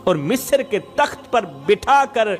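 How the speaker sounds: ordinary talk, nothing marked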